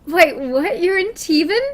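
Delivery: loud incredulous wait, what; blurting out in disbelief; stunned, loud question; shocked disbelief. Real, not acted. Shocked at Antivan claim.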